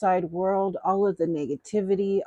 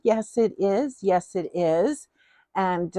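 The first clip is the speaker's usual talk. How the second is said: ordinary talk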